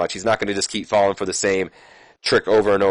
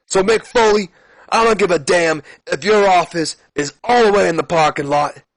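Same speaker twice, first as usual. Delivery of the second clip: Stone Cold promo; 6 semitones higher than usual. Acted. Austin ranting at Mick Foley, hammed-up fury. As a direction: rant it like a furious brawler. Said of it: "Stone Cold Steve Austin takes the mic".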